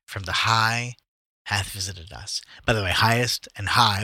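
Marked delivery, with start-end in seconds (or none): 0.0-2.4 slow, solemn Bible reading; scripture reading. Acted. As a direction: read it like scripture, unhurried. Reads scripture aloud.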